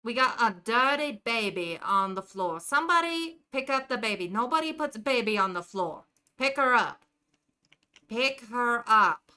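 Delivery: put-on heckling a deadbeat dad in a New Jersey accent; lightly done Jersey wise guy. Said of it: "It leans into stereotypes".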